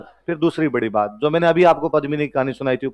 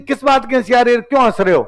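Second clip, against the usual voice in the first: higher, louder mock-angry sultan snapping at his ministers; a hammed-up angry king questioning his court; angry sultan demand; 8 semitones higher, 3 dB louder than usual. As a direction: demand an answer like an offended sultan. Sultan confronts laughing ministers.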